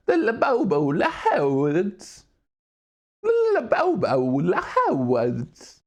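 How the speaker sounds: slow, warped, swooping goofy voice; iDubbbz. Pretend. Streamer talking weird for fun, slow goofy warble. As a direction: drag every vowel out absurdly. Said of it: "Why is talking weird".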